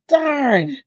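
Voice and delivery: high, slow, drawn-out mock disappointment; mock dismay. Telling viewers the medicine knocked her out, mock dismay. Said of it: "I was like".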